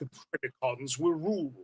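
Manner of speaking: slow villain proclamation; drawn-out, put-on villain voice; Beast Wars villain. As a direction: proclaim it slowly like a cartoon warlord. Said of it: "that voice to it".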